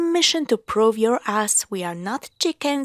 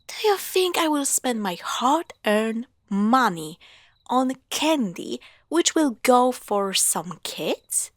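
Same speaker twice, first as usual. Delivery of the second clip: ordinary talk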